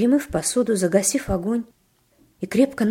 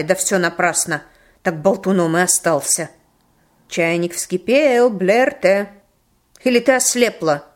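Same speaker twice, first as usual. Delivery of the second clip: loud, stern grandmother scolding; angry scolding; 8 dB louder than usual. Acted. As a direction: scold him sharply and loudly. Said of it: "Бабушка сердито поглядела".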